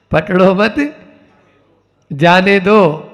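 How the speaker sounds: "पकड़ो मत" as one phrase, pause, then "जाने दो"; group मत with पकड़ो, then break before जाने; pause after मत. Shown as a demonstration. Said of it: "बस इतना ही अंतर है". (पकड़ो मत के बाद विराम)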